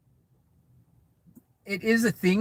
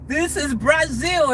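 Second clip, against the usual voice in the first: slow, a touch higher, hammed-up movie line; lighter movie-line quote; 8 semitones higher, 3 dB louder, slower than usual. Vlogger repeats the Fast and Furious line, lightly hammed. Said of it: "my favorite line in the Fast and Furious movie".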